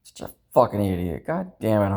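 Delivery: usual speaking voice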